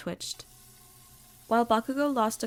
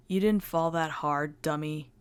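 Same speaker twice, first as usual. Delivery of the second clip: gruff teasing; 4 semitones lower than usual. A narrator's reading. Bakugo teasing after fall.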